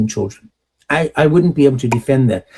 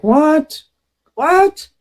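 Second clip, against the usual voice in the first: much higher, startled double "what?"; shocked disbelief; 13 semitones higher than usual. Retold or imitated. Bystander seeing a man strike his wife, shocked disbelief. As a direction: react like you just saw something outrageous.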